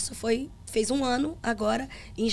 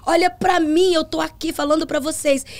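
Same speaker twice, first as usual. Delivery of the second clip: slow, louder preaching cadence; preaching to the church; 4 semitones higher, 5 dB louder than usual. Retold or imitated. Pastor telling the congregation about her father, slow louder preaching. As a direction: proclaim it slowly from the pulpit.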